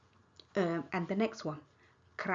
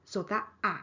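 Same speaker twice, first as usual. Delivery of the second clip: usual speaking voice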